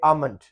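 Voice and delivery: आमंड with no L sound; silent L. Shown as a demonstration. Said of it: "इसमें भी एल silent है". (आमंड में एल silent)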